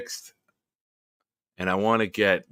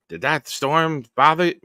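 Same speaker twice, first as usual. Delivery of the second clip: higher, louder worried mother voice; imitating mom; 7 semitones higher, 6 dB louder than usual. Son mimics his mother asking about the storm, higher and louder. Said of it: "if you knew my mother's voice, that's fucking spot on".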